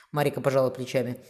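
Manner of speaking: ordinary delivery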